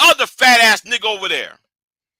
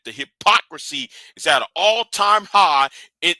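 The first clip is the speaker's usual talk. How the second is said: normal talking voice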